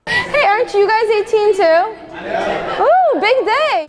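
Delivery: high, slow, laughing call-out; playful squeal at friends; excited teasing. Real, not acted. Teasing friends about turning eighteen.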